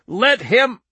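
loud, high shout, cut off; bull-throated shout. A narrator's reading. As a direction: bellow until suddenly cut short. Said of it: "bull-throated".

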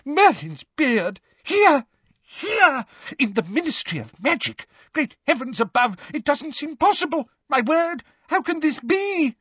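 very high, flustered exclamations; aghast official squeaking in short bursts; gibbering, aghast. A narrator's reading. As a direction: exclaim in panicked disbelief. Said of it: "gibbered Fudge".

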